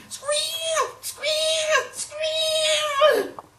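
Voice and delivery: very high, loud pig squeal; imitated pig squealing at full pitch. A narrator's reading. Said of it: "who was still squealing".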